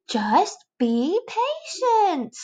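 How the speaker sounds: very slow tired caterpillar; exasperated slow reminder; slow, drawn-out be patient. Acted. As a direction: stretch it out wearily. Other caterpillar.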